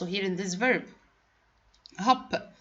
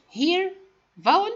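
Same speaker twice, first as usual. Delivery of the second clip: emphatic here; 10 semitones higher, 5 dB louder than usual. Shown as a demonstration. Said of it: "pointing at letters on board".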